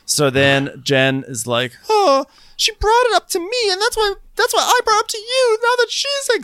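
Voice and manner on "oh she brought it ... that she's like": very high, squeaky mock protest; frantic, hammed-up falsetto deflection; Jen. Podcaster mimics Jen deflecting blame, squeaky mock panic.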